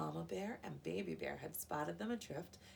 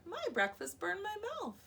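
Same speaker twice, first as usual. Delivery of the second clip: put-on baby bear voice; 13 semitones higher, 4 dB louder than usual. Baby Bear voiced after yowl.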